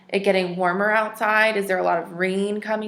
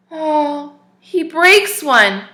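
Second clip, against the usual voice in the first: mock dismay; 6 semitones higher, 7 dB louder, much slower than usual. Narrator gasps at broken plant.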